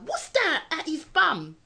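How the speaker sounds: high, drawn-out baffled question; retold puzzlement. Retelling her question to the neighbour, high baffled query. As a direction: ask it high and slow, mock bewildered. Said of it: "I actually said to my neighbour".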